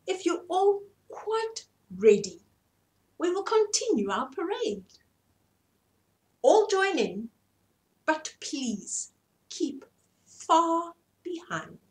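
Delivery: high, lordly peacock voice; haughty peacock. Acted. Peacock ordering the parade, high and haughty. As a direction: speak down to everyone grandly.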